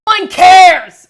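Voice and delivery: loud, high yell; yelled outburst. Pretend. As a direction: belt it out suddenly. Sudden yelled outburst on stream.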